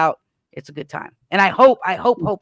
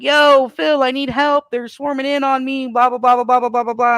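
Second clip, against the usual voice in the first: high-pitched frantic plea, trailing into babble; mock distress call; 7 semitones higher, 4 dB louder than usual. Prisoner radios his commander that he's being swarmed, mock distress. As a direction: play up a radio cry for help, pitched high. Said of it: "the distress radio call was".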